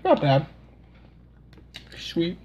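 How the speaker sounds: speaking between bites; talking while chewing. Real, not acted. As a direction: mouth partly full of cake. Seen 1: Speaker took bites just before; jaw and lips show chewing while talking.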